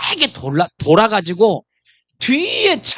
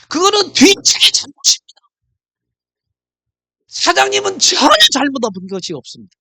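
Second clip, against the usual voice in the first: emphatic insurer; 8 semitones higher, 6 dB louder than usual. Retold or imitated. Insurer explaining who is at fault.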